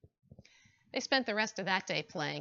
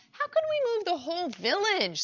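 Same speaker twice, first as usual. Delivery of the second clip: squirrel voice; 9 semitones higher, 4 dB louder than usual. Acted. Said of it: "said somebody else".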